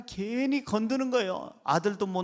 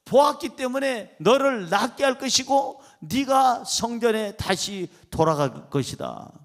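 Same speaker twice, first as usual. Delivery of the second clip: ordinary talk, nothing marked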